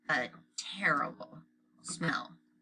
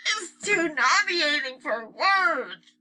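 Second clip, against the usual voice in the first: high, slow, pinched nasal disgust; Libby disgusted with nose held; 10 semitones higher, 6 dB louder, much slower than usual. A narrator's reading. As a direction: say it holding your nose. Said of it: "sounded as if they had the worst colds".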